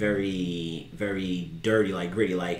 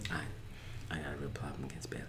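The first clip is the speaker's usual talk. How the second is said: very soft, quick near-whisper; hushed admission; 7 semitones lower, 17 dB quieter than usual. Real, not acted. Podcaster admitting a grudge to co-host, hushed aside. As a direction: admit it under your breath. Sheepish echo of co-host's accusation.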